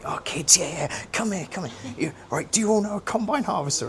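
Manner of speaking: put-on high, bright call to gather the kids; keen teacher beckoning children over; eager teacher call. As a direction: round up the school group with enthusiasm.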